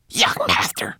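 high, thin little manikin call; small, desperate squeak; crying out. A narrator's reading. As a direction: call urgently in a tiny voice. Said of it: "he cried".

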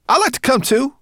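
high, loud little creature; piping creature voice. A narrator's reading. Creature asking to join the journey, piping high.

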